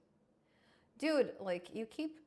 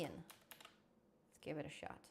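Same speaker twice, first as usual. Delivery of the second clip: slow, breathy under-the-breath try; a slow, hushed whisper to himself; whispered aside; 6 semitones lower, 14 dB quieter, much slower than usual. Real, not acted. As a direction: murmur it to yourself, slowly. Testing the voice app again.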